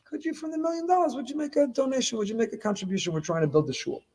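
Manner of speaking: butter them up before asking for money; sweetly asking a favour. Retold or imitated. The donor asks the freed survivors for a gift, coaxing. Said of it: "you say".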